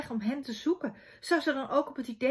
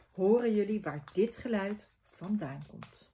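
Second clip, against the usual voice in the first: usual speaking voice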